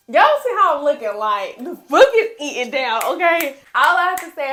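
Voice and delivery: losing it over the book; real fangirl scream. Real, not acted. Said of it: "I'm sorry for all the screaming".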